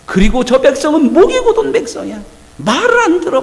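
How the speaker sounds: high, loud stern rebuke; God telling Moses the people will not listen, stern and loud; God's stern anger. Acted. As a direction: condemn the stiff-necked people.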